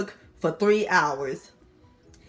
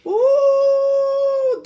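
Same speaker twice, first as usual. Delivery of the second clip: excited squeal; 7 dB louder than usual. Real, not acted. Excited grand finale reveal.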